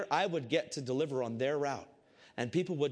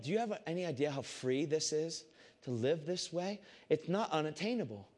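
usual speaking voice

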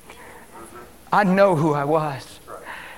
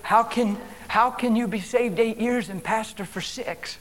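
ordinary talk, nothing marked

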